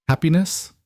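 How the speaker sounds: Happiness? rising high in mild scorn; mock the word Happiness? as foolish; scornful question. A narrator's reading. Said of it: "He said the word like it was foolish".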